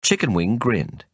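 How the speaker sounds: plain reading voice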